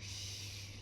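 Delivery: unvoiced hush; whispered shh. A narrator's reading. Grandpa hushing the table, whisper. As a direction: hush them gently. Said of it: "said Grandpa".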